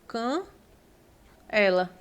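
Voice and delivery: "Can" then "ela", with a pause between and a nasal "Can"; split syllables. Shown as a demonstration. Teacher showing the class the wrong reading with a nasal A. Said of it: "como é que seria a escrita".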